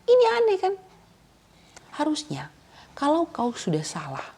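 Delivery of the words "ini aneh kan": much higher, louder rhetorical disbelief; preacher to congregation, loud and incredulous. Real, not acted. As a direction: sound amazed at how strange it is. Preacher marvels at the absurdity.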